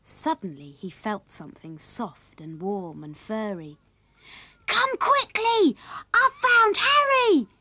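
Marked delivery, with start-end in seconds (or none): 4.7-7.5 high, loud, slow drawn-out yell; excited shout. A narrator's reading. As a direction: shout the discovery for the whole house to hear. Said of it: "he shouted".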